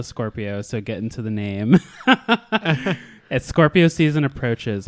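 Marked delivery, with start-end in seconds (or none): 1.8-3.0 high giggling burst; high laugh. Real, not acted. Laughs at own joke.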